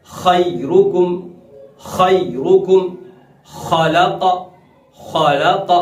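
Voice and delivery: खैरुकुम and खलक slowly with a thick throaty kh; full khaa. Shown as a demonstration. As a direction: recitation demo, heavy fricative kh in खैरुकुम, खलक. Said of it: "हम खा को पढ़ते हैं". (खैरुकुम, खलक का खा पुर, मोटा)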